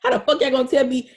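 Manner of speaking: high, laughing mock indignation; amused half-angry rant; laughing outrage. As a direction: laugh through the disbelief. Laughing retelling her outrage.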